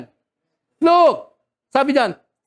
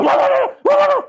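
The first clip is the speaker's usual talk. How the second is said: yappy dog bark, much higher in pitch. Retold or imitated. Preacher imitating a dog that sees something, high yappy bark. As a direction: bark like an excited little dog. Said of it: "Kahit yung aso".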